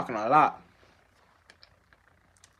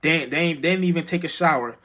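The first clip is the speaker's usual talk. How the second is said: ordinary talk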